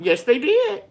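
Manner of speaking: high, eager yes; emphatic agreement. Real, not acted. Agreeing with a viewer about ancestors, eager and high. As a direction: jump up in pitch to agree wholeheartedly.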